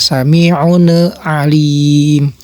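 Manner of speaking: slow "sami'un alim" with the n rebounding as "ne"; wrong demo, extra vowel after n in "sami'un alim"; bounced n. Shown as a demonstration. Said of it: "jangan ada pantulan".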